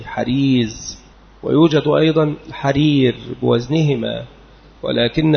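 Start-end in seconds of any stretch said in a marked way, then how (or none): 0.1-1.0 حريز with a clear throaty ح and a final z, ḥarīz; careful pronunciation. Shown as a demonstration. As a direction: say حريز slowly, opening on ḥ and ending on z. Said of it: "أوله حاء مهملة وآخره زاي". (حريز بحاء مهملة وزاي في آخره)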